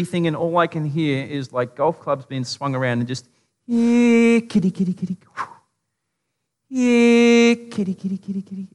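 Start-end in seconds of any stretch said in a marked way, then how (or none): 3.7-5.2 high mock-menacing "kitty, kitty" call; taunting kitty call. Grown men with golf clubs hunting the boys: high, taunting "kitty, kitty" call. 6.7-8.7 high mock-menacing "kitty, kitty" call; taunting kitty call. Grown men with golf clubs hunting the boys: high, taunting "kitty, kitty" call.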